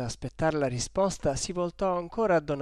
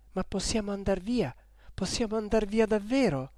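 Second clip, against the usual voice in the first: ordinary delivery, nothing marked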